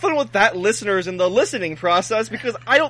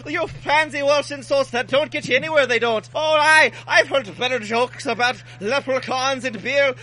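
hammed-up fake Irish accent, pitched higher; bad Irish accent; 8 semitones higher than usual. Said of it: "extravagant tales in an Irish accent".

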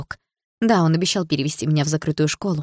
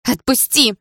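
high, urgent cry; sharp cry of protest; desperate shout; 8 semitones higher, 3 dB louder, slower than usual. A narrator's reading. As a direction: demand to be let go. Grabbed girl demands release.

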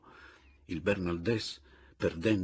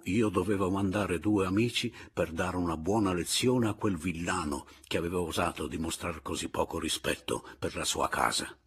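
ordinary delivery, nothing marked

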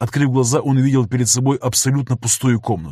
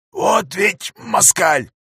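smiling through split lips; 8 semitones higher, much slower than usual. Acted. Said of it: "улыбнулся Лёня разбитыми губами".

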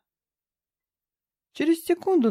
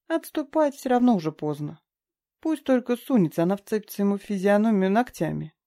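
plain reading voice

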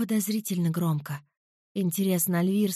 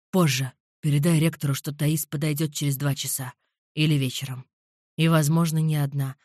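lower, darker man's voice; low male voice; 6 semitones lower than usual. A narrator's reading. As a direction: voice him low and calm. Alvir orders.

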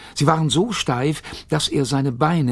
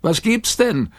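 high, louder shouted question; calling back; 9 semitones higher, 3 dB louder than usual. A narrator's reading. Robbie calling down to his father, high shout. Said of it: "rief Robbie zurück".

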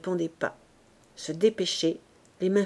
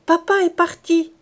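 put-on much higher, louder child's cry; child's clear cry; 7 semitones higher, 8 dB louder than usual. Little boy cries out to his mother, high clear shout. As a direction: blurt it out like a small boy. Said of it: "cria de sa voix claire".

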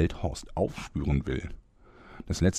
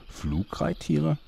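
baffled; 10 semitones higher, slower than usual. Acted. Old narrator baffled by new things.

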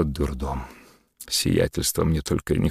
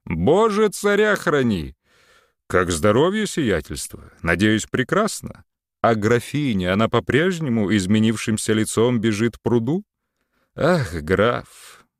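carried away; 6 semitones higher than usual. A narrator's reading. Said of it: "понесло Антона".

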